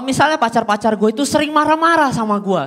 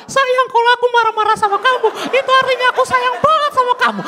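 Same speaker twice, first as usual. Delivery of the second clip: much higher, sugary girlfriend impression; mocking girlfriend voice; 11 semitones higher than usual. Comedian redoing the girlfriend line, higher mock coo.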